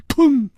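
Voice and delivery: sudden high, loud "bang"; imitated impact bang. A narrator's reading. Narrator voicing the collision of two fighters, sudden bang. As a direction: punch out a sharp crash noise. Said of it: "一声响".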